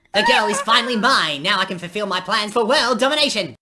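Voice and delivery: high, loud hammy gloating; evil alarm clock villain. Acted. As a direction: gloat like a cartoon supervillain over his captive. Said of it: "captured by the evil alarm clock".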